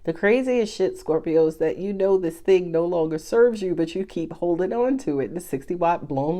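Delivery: usual speaking voice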